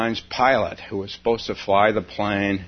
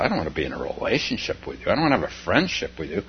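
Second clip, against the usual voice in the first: fast, dismissive rattle; hurried brush-off; 4 dB quieter, much faster than usual. Acted. Guarded self pushing away kindness, fast brush-off. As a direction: toss the refusal off quickly.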